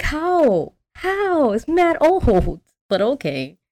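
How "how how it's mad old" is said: high-pitched disbelief; baffled, voice jumping up; incredulous. Real, not acted. Podcaster reacts to the old truck still running, incredulous.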